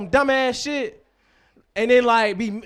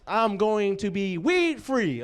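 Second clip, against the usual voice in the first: usual speaking voice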